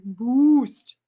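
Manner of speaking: "boost" said loud and drawn out; weird pronunciation. Shown as a demonstration. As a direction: overdo the word "boost" as one punched, stretched syllable. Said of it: "I don't know why I say that so weird".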